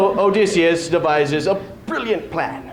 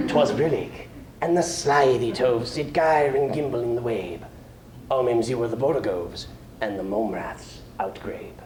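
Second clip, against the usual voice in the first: softer, lower, fading reprise; soft closing verse; 3 semitones lower, 5 dB quieter than usual. Acted. Closing Jabberwocky for the audience, quietly fading. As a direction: let the nonsense verse die away.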